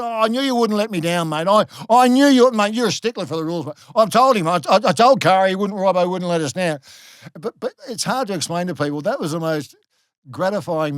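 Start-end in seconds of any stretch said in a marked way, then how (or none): 0.0-6.8 imitated relieved outburst, loud and high; huge relief. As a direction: gush loudly, as if a weight has lifted. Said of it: "Huge relief for him".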